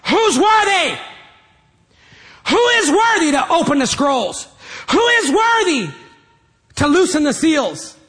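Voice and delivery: a strong angel shouting to all heaven; thundering loud voice. Acted. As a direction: proclaim it high and booming. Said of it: "He's proclaiming with a loud voice".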